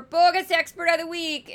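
hammed-up Minnesota accent for the segment title. Host announcing the bogus expert to viewers in a mock Minnesota accent. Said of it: "as I do my Minnesota accent".

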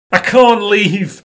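loud, high, drawn-out whine. Acted. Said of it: "he whines".

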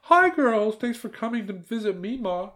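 high-pitched aunt's greeting, loud at first; calling out. A narrator's reading. Aunt welcomes the girls from the kitchen, calling out. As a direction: trill a welcome from another room. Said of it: "called out from the kitchen".

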